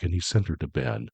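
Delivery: ordinary delivery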